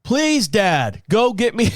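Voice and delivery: a mild mock of his scolding wife; imitating wife. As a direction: tease her insistence on please. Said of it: "She would go".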